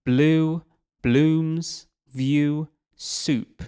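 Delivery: "Blue", "blooms" and "view" said high and loud, one at a time; rhymes stressed. Shown as a demonstration. Teacher calls out the rhyming end words. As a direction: punch out each rhyming word. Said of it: "consistent rhyme scheme".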